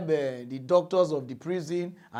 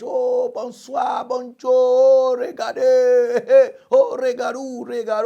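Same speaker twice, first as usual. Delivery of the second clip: high, loud, slow mock French fans shouting; excited French fans; 8 semitones higher, 12 dB louder, slower than usual. French fans shouting as the ground shakes, loud and excited. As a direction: ham up a crowd calling out in French. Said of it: "They dey speak French".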